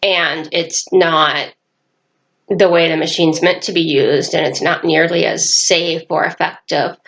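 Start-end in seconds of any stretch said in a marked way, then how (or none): none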